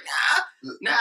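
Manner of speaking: high, whiny "Nah, nah"; mock groggy refusal. Refusing the uncle waking him, high whiny mock protest. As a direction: whine it like a half-asleep teen. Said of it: "And I was like".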